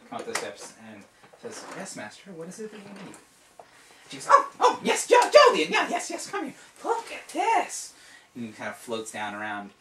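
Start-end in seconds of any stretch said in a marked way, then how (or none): 1.8-3.1 soft, deferential servant voice, higher; meek assistant addressing her master; servile apprentice. Acted. 4.3-7.9 very high, loud, squeaky wizard voice; excited old wizard. Acted. GM voices Saul the master.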